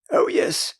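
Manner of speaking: high, breathy prim little answer; primly. A narrator's reading. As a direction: say it neatly, a touch superior. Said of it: "Wendy said primly".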